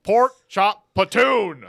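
loud, slow, high proclamation; proud announcement. Acted. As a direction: announce it like a title.

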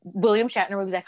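ordinary talk

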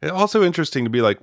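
usual speaking voice

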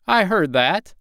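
from another room. Acted. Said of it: "Simpkins says from the other room".

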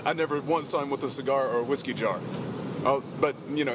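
usual speaking voice

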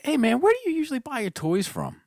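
high, bright mock-innocent question. Teller interjecting to a customer, high mock-innocent voice. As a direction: play innocent to set up the joke. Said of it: "I was like".

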